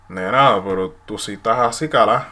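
loud, flirty Puerto Rican Spanish; complimenting a woman, Puerto Rican style; Puerto Rican accent. Shown as a demonstration. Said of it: "you can say, like".

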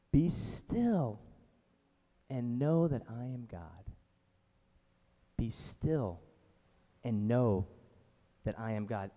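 soft, slow reading of the verse; hushed scripture read; reverent scripture reading. Acted. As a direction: let each word rest.

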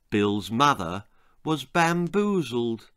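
usual speaking voice

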